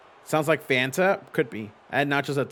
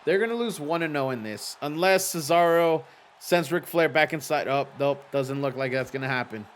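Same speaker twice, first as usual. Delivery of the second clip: normal talking voice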